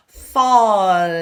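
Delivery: "Falling" said loud, ng resonating in the nose; ng through the nose. Shown as a demonstration. Coach shows the nasal ng in falling. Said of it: "suction cup to the roof of your mouth".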